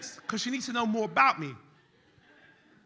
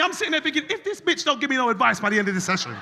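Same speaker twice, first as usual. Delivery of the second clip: ordinary delivery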